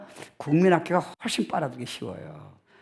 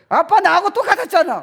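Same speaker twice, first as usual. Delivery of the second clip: put-on high, loud, laughing sassy kid; child talking back; 9 dB louder than usual. Child retorting to a nagging father, loud and high. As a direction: fire it back like a cheeky kid.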